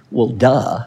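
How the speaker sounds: low, dry statement of the obvious; deadpan duh. Acted. Preacher mocks the extrovert reading, deadpan duh. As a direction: drop the pitch on a dismissive "duh".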